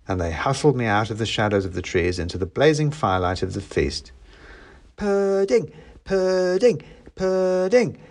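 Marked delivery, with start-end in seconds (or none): 5.0-8.0 high, excited chant; children shouting. A narrator's reading. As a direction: call out like children clamouring for dessert. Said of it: "shouted the children".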